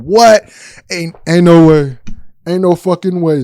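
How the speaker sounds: loud, high stunned disbelief; can't believe what he's hearing. Real, not acted. Storyteller stunned by the couple's antics, loud disbelief.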